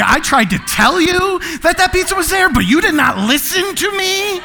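high, exasperated mock telling-off; scolding prophet. Prophecy type scolding the one who didn't listen, exasperated. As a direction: climb into indignant, hammed-up reproach. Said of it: "you probably got the gift of prophecy".

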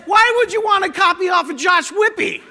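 high, loud shout breaking into laughter; mock the cheater through laughter; laughing shout. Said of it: "And then he says".

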